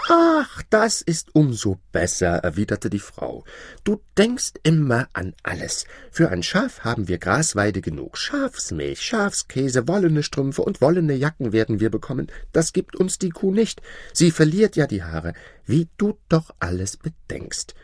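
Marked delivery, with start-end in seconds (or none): 0.1-2.4 high-pitched gasp of approval; delighted wife. Acted. Wife welcomes the sheep, high and delighted. 3.8-17.7 high-pitched happy housewife; admiring, delighted wife. Acted. Wife praises her husband for the sheep, delighted.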